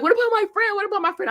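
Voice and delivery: very high, loud mimicked outburst; frantic imitation. Imitating the hypnotized woman asking after her friend, high and frantic. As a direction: imitate her in a high-pitched, urgent repeat.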